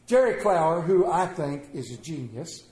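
normal talking voice

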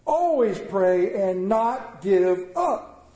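very slow, each word spaced; preacher slowing for the key line; slow emphasis. Acted. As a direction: lay it down word by word.

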